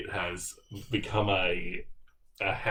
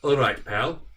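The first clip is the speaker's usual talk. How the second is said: a stab at Scottish "All right, pal." with a louder rise on "All"; attempted Scottish greeting; 4 semitones higher, 6 dB louder than usual. Shown as a demonstration. Greeting the camera in a half-hearted Scottish accent. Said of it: "I'm not sure that's how they say it".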